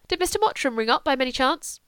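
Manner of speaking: a hint of a bored young lady; lightly voiced young Julia. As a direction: sound offhand, as if you barely care. Julia asking the maid.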